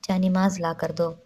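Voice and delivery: usual speaking voice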